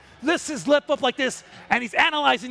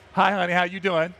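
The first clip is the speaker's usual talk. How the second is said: lower, coy greeting; flirty lioness; 6 semitones lower than usual. Retold or imitated. Lioness greeting the male, low and coy. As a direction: tease him lightly as the lioness. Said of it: "she goes".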